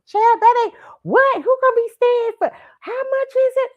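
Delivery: put-on very high, loud old lady squawk; shocked grandma. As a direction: squeal it like a flabbergasted grandma. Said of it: "she busts a".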